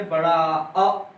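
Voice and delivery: बड़ा अ said slowly, vowel stretched; slow teaching demo of long vowel. Shown as a demonstration. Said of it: "उनको बोलने में".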